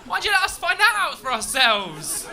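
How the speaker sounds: loud, high, drawn-out complaint; indignant comic shouting; outraged yell. Acted. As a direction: yell it slowly in exasperation. Mock outrage at Londoners continues.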